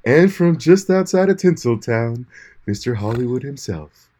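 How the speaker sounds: slow grand announcer build; announcer intro. Pretend. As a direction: hype him like a star. Hammed-up host introduction.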